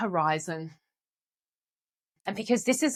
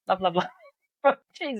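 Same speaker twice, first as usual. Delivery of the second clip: laughing exasperation; faster than usual. Real, not acted. Laughing about teenage son.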